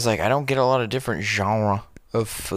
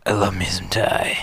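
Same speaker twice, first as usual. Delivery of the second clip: whisper. Pretend. Whispered food bit.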